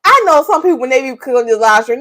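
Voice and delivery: ordinary delivery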